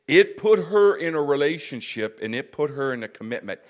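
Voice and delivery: loud, stretched emphatic sermon delivery; forceful preaching. Real, not acted. Preacher urging people to become servants of God, forceful preaching. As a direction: press the congregation hard, loudly.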